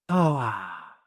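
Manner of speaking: long audible sighing exhale; deep breath out. Retold or imitated. Man retelling her breathing instruction, deep breath out. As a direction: demonstrate a deep breath, letting it out on "ah". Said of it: "take a deep breath".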